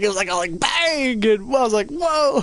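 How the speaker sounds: high, loud hammed-up whoa; mock excited retelling. Player imitating a character's retelling, hammed up and loud. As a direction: ham up the bang and the whoa. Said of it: "He's like".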